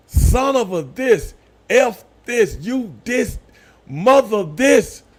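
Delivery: cursing at the TV. Retold or imitated. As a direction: rant at the screen like the game is on. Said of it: "loud as hell".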